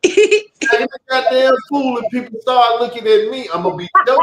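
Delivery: shrill, very high, loud mocking imitation; mimicking his friend. Man mimics his friend's scolding voice, very high and loud, laughing. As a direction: screech it like a laughing parody of her. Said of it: "Hey, yo, you sound like me".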